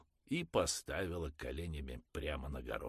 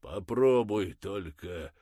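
serious threat; 3 semitones higher, 8 dB louder, slower than usual. Acted. Father warning the mother, slow and menacing. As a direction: threaten him in earnest. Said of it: "нешуточная угроза".

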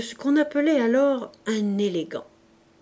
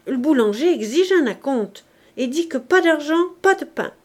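a hint of a grumbling old woman, louder; nagging old woman; 5 semitones higher, 6 dB louder than usual. A narrator's reading. The old housekeeper scolds Mabeuf about unpaid rent, nagging. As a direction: press him, complaining and insistent.

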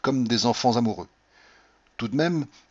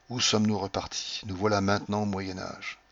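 usual speaking voice

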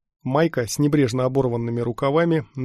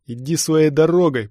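loud, raised warning; threatening dismissal; 4 semitones higher, 7 dB louder than usual. A narrator's reading. Stormtrooper warns off the intruder, threatening. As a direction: send him off forcefully.

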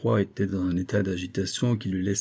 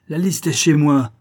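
gloating shout; 5 semitones higher, 7 dB louder than usual. Acted. Poisoner boasts of his list.

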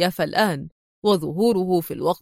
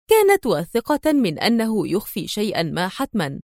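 usual speaking voice

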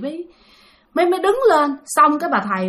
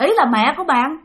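ordinary delivery

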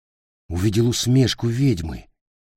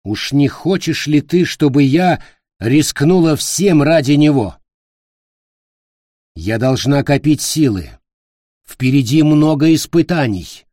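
raised, imperious and loud; angry queen; 6 semitones higher, 8 dB louder than usual. Acted. Queen angrily refusing to risk everything. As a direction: dismiss him with cold anger. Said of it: "гневным огнём".

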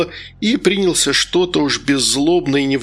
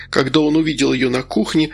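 plain reading voice

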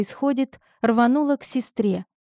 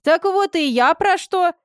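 glad; 9 semitones higher, 3 dB louder than usual. A narrator's reading. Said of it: "обрадовалась санитарка".

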